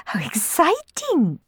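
high, slow, gushing squeal; mock-shy gush. Sisters faking shyness before the courtiers, gushing. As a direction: fake delight for the guests. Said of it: "pretending to be shy".

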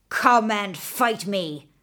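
slow, lightly voiced dragon challenge; dragon shouting. A narrator's reading. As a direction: drag out the taunt like a monster, only a hint. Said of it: "the dragon shouted".

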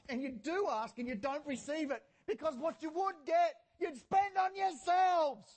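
emphatic pulpit delivery building to a drawn-out shout; forceful preaching. Real, not acted. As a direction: drive each point home to the congregation.

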